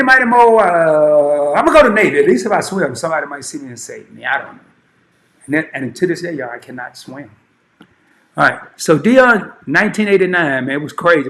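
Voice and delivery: usual speaking voice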